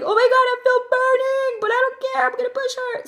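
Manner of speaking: very high, strained squeal; burning but determined. Retold or imitated. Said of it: "So I felt like".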